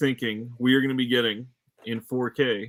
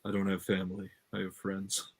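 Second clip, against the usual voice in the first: soft, slightly lowered movie-line delivery; deep voice; 4 semitones lower, 10 dB quieter than usual. Acted. Co-host flips the family line on request, deep voice. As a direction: say it low and quiet, like a brooding tough guy. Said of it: "say it in a deep voice".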